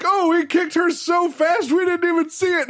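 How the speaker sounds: high, excited announcer-like voice; amazed crowd. Retold or imitated. Crowd marveling at the fake kick, high and excited. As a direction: gasp it out as the crowd.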